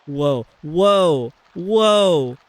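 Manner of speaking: amazed whoa. Real, not acted. Reacting to something trippy in game.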